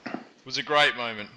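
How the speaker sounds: normal talking voice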